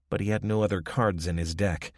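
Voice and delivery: ordinary delivery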